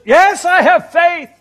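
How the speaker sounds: high, loud, slow and earnest assurance; a desperate man promising God he has faith; eager yes. Retold or imitated. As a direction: insist hard that you believe.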